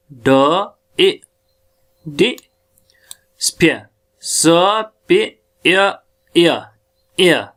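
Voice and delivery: "डी, स्पियर" taken apart sound by sound; isolated sounds with pauses, ending on the glide "य"; sounding out phonemes. Shown as a demonstration. Teacher breaking down disappear, sounding out each phoneme. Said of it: "ये जो ए है ये डिफिथांग है".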